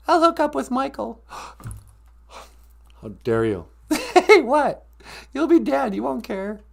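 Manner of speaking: high, giggly needling voice; high-voiced sidekick, teasing. Sidekick teasing partner about dating Michael, high teasing voice. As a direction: tease in a high little voice.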